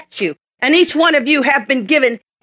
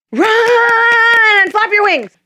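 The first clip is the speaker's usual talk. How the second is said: high, louder, very slow cheer; drawn-out high coaching call; high excited urging; 11 semitones higher, 4 dB louder, much slower than usual. Acted. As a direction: cheer the eaglet on to take off. Young eagle coaching eaglet.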